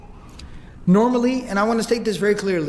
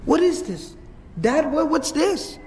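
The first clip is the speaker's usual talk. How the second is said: higher-pitched, alarmed questioning of a father; acted daughter, aghast and pleading; shocked daughter; 7 semitones higher than usual. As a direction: retell a girl's shocked dismay. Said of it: "and she gasps".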